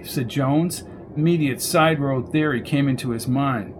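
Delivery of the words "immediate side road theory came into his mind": plain reading voice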